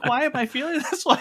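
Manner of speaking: high, fast flustered wail. Retold or imitated. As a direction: panic over your own feelings. Acting out character's confusion.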